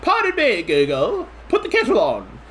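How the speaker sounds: put-on high, prim old-lady voice asking Google; old English lady. Old biddies watching EastEnders, prim high old-lady voice. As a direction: play a proper little English granny. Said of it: "all the old biddies in England just being like".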